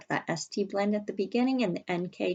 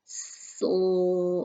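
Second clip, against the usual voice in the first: slowly stretched. Shown as a demonstration. Teacher stretching Slip slowly to show the blend. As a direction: Slip drawn out slowly, sound by sound. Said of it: "We have to say it slowly".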